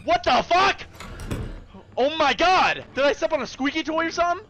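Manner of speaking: high, loud startled outburst; shocked. Real, not acted. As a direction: blurt out in shock, fast. Scared by squeak.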